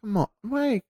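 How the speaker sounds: high, animated protest; pleading for the hermits; excited. Real, not acted. Playful squabble about lonely hermits.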